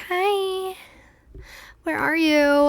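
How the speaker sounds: bright phone greeting. Retold or imitated. Phoning the taxi driver.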